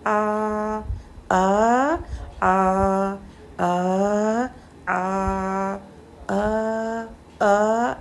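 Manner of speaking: आ drawn from mid-throat, repeated; ain sound. Shown as a demonstration. Teacher contrasting ain with hamza. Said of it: "और आइन की sound आ".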